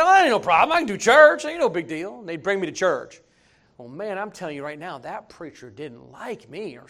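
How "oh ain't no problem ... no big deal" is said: loud, high boastful swagger; tossing off the invitation like it's nothing; cocky brush-off. Retold or imitated. Retelling how he brushed off going to church, cocky and loud.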